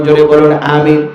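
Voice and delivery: ordinary talk, nothing marked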